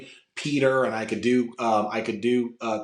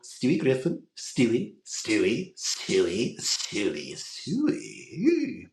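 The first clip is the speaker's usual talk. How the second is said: Stewie morphing into Joker; much slower than usual. Shown as a demonstration. Showing the host how Stewie turned into Joker, character impression. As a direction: repeat the name, sliding from cartoon voice into villain. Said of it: "if I tuned Stewie, made him darker".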